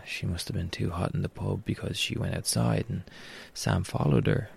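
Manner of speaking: ordinary delivery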